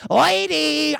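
high, loud zany Jerry Lewis squawk; a preacher aping a comic shriek over a poker tell; Jerry Lewis impression. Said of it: "Jerry Lewis going".

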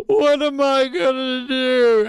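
high, loud, slow hammed-up wail; church lady panic. The church lady after tripping on the chair, hammed-up high wail. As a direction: wail helplessly like the old lady. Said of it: "and go".

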